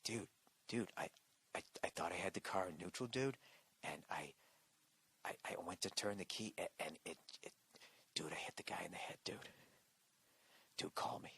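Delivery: hushed, frightened stammer into the phone; scared whisper. Retold or imitated. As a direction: whisper it like a terrified buddy leaving a phone message. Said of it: "He's whispering. That's how fucking scared he is".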